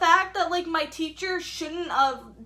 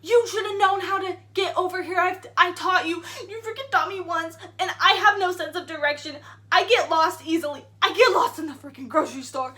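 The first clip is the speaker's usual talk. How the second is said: loud, high, exasperated rant; angry scolding teacher; 4 semitones higher, 7 dB louder than usual. Retold or imitated. Retelling the science teacher yelling about getting lost, angry and loud. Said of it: "She's like".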